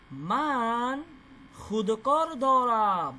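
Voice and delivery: মান খুদকর দরাম stretched very slowly; slow example reading. Shown as a demonstration. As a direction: read the example word by word, very slow. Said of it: "এখানে উদাহরণ দিছে". (মান খুদকর দরাম খুব ধীরে)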